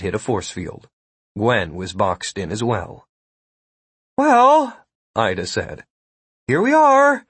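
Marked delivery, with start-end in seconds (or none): 4.2-4.8 high, louder drawn-out voice; high character voice. A narrator's reading. Ida's voice in narrated standoff. 6.5-7.2 high character voice. A narrator's reading. Ida's voice in narrated standoff.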